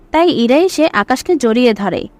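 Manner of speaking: normal talking voice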